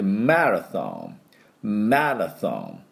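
"マラソ" slow, strong ma, th tongue between teeth; English marathon. Shown as a demonstration. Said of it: "マラソンっていう風に発音します".

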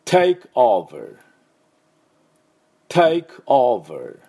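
"Take over" slowly and loudly, every sound clear; drawn-out "Take over" with buzzed V; model pronunciation. Shown as a demonstration. Teacher modelling for repeat-after-me, slow clear "Take over". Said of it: "私の後で発音どうぞ".

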